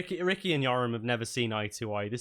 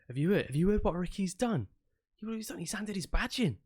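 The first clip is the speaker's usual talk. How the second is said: lightly voiced guard spreading news, higher pitch; gossiping guard; 6 semitones higher, faster than usual. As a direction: pass on juicy gossip in a higher voice. Guard excitedly gossiping about Ricky quitting.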